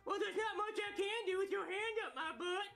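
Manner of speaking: high cheeky puppet voice; dummy voice. Acted. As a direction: sass back like a puppet. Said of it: "ventriloquist dummy".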